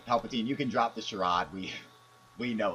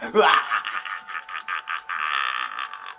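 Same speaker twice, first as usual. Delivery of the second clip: evil laugh; 5 semitones lower, 7 dB louder than usual. Acted. Evil laugh after confession.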